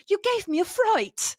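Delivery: mock a posh British girl's line; British accent. Quoting Annie's line from the film, light British accent. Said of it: "a moment where Annie says something".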